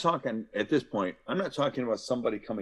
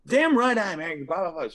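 angry shout; 7 semitones higher, 4 dB louder than usual. Acted. Voicing angry person in role-play.

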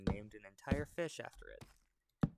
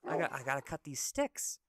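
higher-pitched character voice, asking a favor; friendly old man; 8 semitones higher, 4 dB louder than usual. Acted. Old man asking the monkey for help, friendly. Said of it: "the old man goes".

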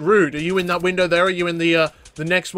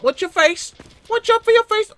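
teasing warning; 12 semitones higher than usual. Real, not acted.

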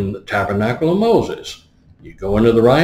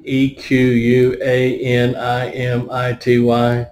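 spelled out; slower than usual. Shown as a demonstration. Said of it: "for those who know how to pronounce".

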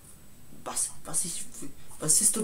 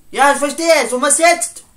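much higher and louder; angry shout; 7 semitones higher, 12 dB louder than usual. Acted. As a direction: fire it back, provoked. Provoked shouting back. (laut und gereizt)

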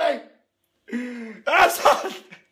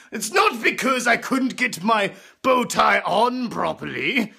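pompous upper-class toff voice; hammed-up snooty aristocrat; posh rich man; 3 semitones higher than usual. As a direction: lord it over the poor peasants. Posh rich man character throughout.

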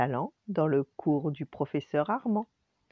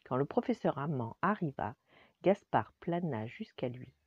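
usual speaking voice

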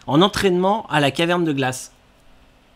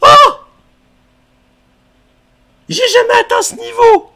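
high, loud thrilled cry; excited shout; 11 dB louder than usual. Real, not acted. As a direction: yell with joy at the level. Excited at new level.

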